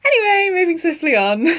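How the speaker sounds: high-pitched, laughing change of subject; awkward brush-off. Real, not acted. Brushing off her awkward confession.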